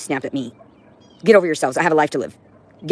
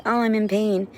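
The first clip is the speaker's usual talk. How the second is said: whiny mock complaint; 7 semitones higher than usual. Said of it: "He was like".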